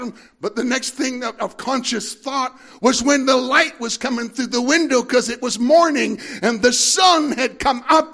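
loud, high, rising sermon climax; preaching at full force. Acted. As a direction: preach it out to the congregation with fire.

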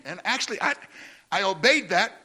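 ordinary talk, nothing marked

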